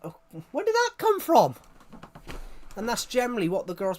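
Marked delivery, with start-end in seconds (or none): 0.0-1.5 much higher, loud shocked squeak; baffled. Retold or imitated. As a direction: squeak out the question in disbelief. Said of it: "I'm like".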